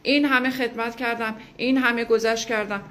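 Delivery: mock plaintive tone of one who gave everything; self-pitying complaint. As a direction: mimic someone listing their sacrifices.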